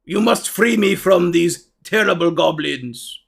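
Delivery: slow, deliberate ogre plea; pleading ogre. Acted. Caged ogre begs the adventurer for rescue, pleading. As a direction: plead ponderously, word by word.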